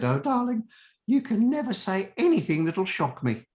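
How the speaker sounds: high-pitched, Dame Edna-style Australian; bad Australian accent. Actress telling her new co-star she is unshockable, hammed-up Australian accent. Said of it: "my bad Australian accent".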